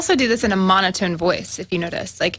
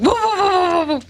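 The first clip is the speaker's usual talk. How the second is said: high laughing woo-woo yelps; 10 semitones higher than usual. Pretend. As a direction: whoop it up mid-laugh. Cohost riffs on barking, laughing.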